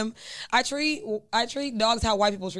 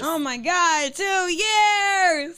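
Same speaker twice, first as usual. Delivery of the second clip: excited; 8 semitones higher, 4 dB louder, much slower than usual. Real, not acted. Cohost marvels at two years.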